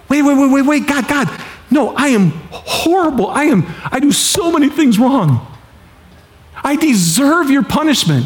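high, urgent begging; pleading. Pretend. Believer begging God, knowing they deserve punishment, pleading high. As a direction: plead with God in sudden panic.

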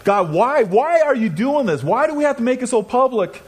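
retold desperate plea, voice climbing and straining; crying out to God. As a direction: cry up to heaven in desperation. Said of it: "Now I'm crying out to God".

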